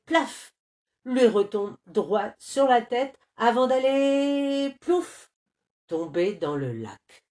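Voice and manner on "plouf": "plouf" squeaked high. A narrator's reading. Narrator dropping the nut into the lake, high. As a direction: splash it high and quick.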